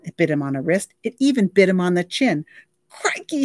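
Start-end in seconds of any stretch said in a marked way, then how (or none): 2.9-3.4 whimpering. Acted. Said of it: "he whis-, whimpered over and over".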